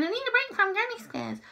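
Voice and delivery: high-pitched mock complaint; put-on high voice for moaning to yourself; mock self-quote. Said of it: "I'm just going".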